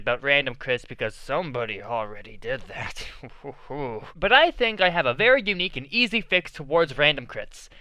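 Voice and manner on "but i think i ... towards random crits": normal talking voice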